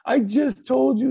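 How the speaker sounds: high, loud panicky Muppet voice; hammed-up high Grover yelling at the reader; frantic Grover. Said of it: "terrified Grover".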